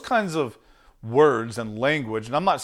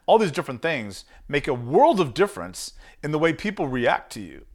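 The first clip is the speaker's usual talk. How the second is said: ordinary delivery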